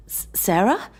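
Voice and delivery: stammering, rising in disbelief; stunned, can't believe it's her; incredulous. A narrator's reading. John to his ex-wife, incredulous stammer. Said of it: "he asked incredulously".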